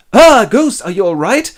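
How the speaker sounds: loud, high gasp into a worried question; startled concern. Acted. Scrooge sees the ghost looking sick, alarmed. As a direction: cry out in alarm and check on them.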